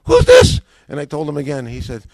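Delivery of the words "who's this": "Who's this?" shouted much higher and louder; rabbi's irritated shout. Retold or imitated. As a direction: bark "Who's this?" like a fed-up rabbi. Caller repeats, rabbi loses patience.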